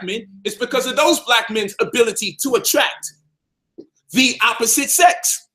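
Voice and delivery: slow, deliberate restating. Shown as a demonstration. Repeating his point slowly for viewers who missed it. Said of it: "Let me say it slower".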